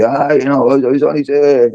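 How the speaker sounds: high, meek excuse; a pitiful high-pitched backpedal from a bully; sheepish backing down. Retold or imitated. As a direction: act small and pitiful, backpedalling. Bully backing down when confronted.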